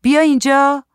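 high, loud calling out; shouted summons. A narrator's reading. Woman summons husband from the hall, high shout. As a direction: shout an order across the flat, pitched high. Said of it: "زن داد زد".

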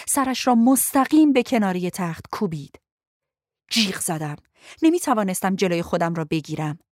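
ordinary delivery, nothing marked

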